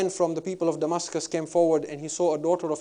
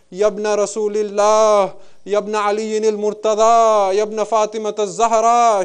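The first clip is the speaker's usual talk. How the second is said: crying out; 5 semitones higher, 8 dB louder, slower than usual. Acted. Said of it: "She begins to cry out".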